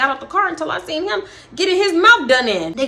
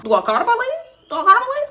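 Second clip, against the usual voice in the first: much higher, frantic self-questioning; alarmed, unsure whether to call for help; panicked; 10 semitones higher, faster than usual. Retold or imitated. Watching the fight, panicked about calling police. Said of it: "'Cause, bitch, I've been there".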